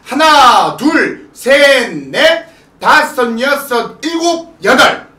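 loud, slow count with stretched beats; dance count. Shown as a demonstration. Teacher showing how the routine starts, loud drawn-out count. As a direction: punch out each beat like an instructor.

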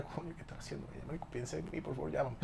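very soft, private pleading; súplica en voz baja. Retold or imitated. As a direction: plead under your breath, as if alone at night. Súplica nocturna a la ex, recordada. (súplica muy bajita)

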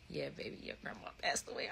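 whispered, gentle and low; soft whisper. Retold or imitated. Mom breaking bad news to her daughter, whispered softly. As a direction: breathe it out softly. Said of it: "She said".

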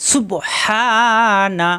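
সুবহানা very slow with a clear throat h; drawn out সুবহানা, su-bo-ha-na; stretched tajweed সুবহানা; correct pronunciation. Shown as a demonstration. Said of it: "সুবানা পড়া যাবে না".

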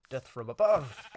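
loud booming attack yell; battle cry. Acted. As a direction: shout it as you drop on them.

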